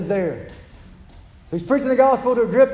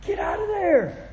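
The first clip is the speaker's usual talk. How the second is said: urgent cry; 10 semitones higher, 4 dB quieter, slower than usual. Retold or imitated. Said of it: "You say".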